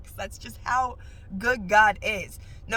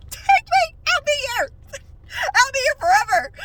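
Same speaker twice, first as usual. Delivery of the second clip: very high, loud ecstatic squeal, half laughing; ecstatic cry; 9 dB louder than usual. Retold or imitated. Answering God's call at the salon, ecstatic high cry. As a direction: throw yourself at it with joy.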